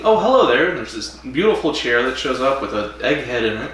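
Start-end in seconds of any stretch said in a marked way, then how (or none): 0.0-0.7 higher and louder, a startled hello; mock surprised greeting. Doctor startled by the old man in the chair, mock surprised hello. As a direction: greet the newcomer with a jolt of surprise.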